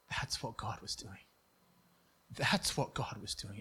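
low, soft and slowing, letting it sink in; quiet awe, voice dropped right down; hushed realization. Retold or imitated. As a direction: say it softly, as a dawning realization. Hushed realization told to companion.

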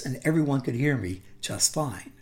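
normal talking voice

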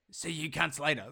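a light, goofy put-on voice; playfully silly farewell, lightly voiced. To female colleagues while waving, in a mildly silly voice. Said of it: "silly sort of voice".

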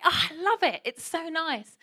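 high, loud delighted reply; playfully overdone enthusiasm; mock gushing. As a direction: gush with exaggerated delight. Acted gushing reply to spa woman.

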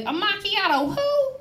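high, drawn-out puzzled question; imitated baffled worker. Drive-thru worker to customer ordering macchiato, imitated bafflement.

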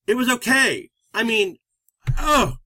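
exasperated, pitched high with a big sigh-like "oh"; frustrated. Retold or imitated. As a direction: retell an irritated end-of-day grumble. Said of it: "sound so frustrated".

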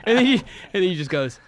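loud and high while laughing; laughing through the setup. Real, not acted.

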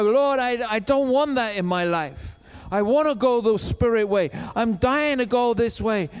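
loud, high impassioned preaching; loud passionate preaching; preaching at full force. Acted. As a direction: fire it at the congregation.

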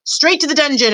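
high-pitched crowd shout; high call from the crowd. A narrator's reading. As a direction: shout a condemnation. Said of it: "another voice shouted".

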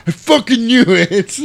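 high, loud and slow triumphant yell; gloating shout. Retold or imitated. Iceman surrounded by enemy planes, slow loud gloat. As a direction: drag it out, crowing with glee.

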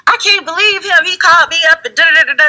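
very high, mock fed-up rant; exasperated friend. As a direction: play the friend venting again. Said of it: "they're like".

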